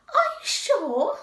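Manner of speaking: high, loud surprised question; astonished. A narrator's reading. Rabbit doubting it is Pooh, very surprised. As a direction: shoot the pitch up in disbelief. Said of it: "still more surprised".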